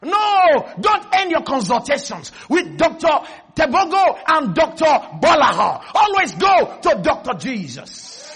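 high, loud preacher's shout; full-force preaching. Real, not acted. Preacher rousing the congregation to shout amen, full-force shouted preaching. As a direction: preach it at the top of your voice.

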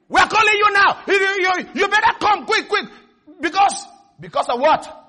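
high, shouted, insistent demand; rude debt collector. Acted. Debt collector phoning someone who owes money, demanding. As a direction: hound the debtor loudly. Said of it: "learn manners of how to talk to people".

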